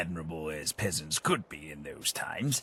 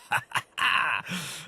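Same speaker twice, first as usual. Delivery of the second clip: bright rising chuckle of delight; a pleased little laugh before the tale; 9 semitones higher than usual. Acted. Gentleman thrilled to be asked, chuckling.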